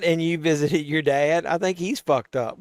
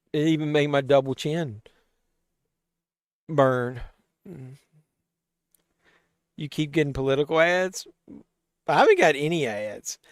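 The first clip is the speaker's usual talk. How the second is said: ordinary delivery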